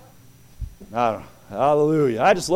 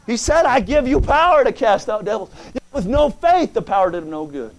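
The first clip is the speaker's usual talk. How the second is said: higher, louder preaching with rising fervor; fired-up preaching; 6 semitones higher, 4 dB louder than usual. Acted. Pastor exhorts the church about faith, fired-up preaching. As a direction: drive it home to the congregation.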